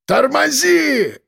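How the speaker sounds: high, drawn-out scream; panicked yell. A narrator's reading. Passenger screaming at the driver to brake, panicked yell. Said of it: "заорал Димон".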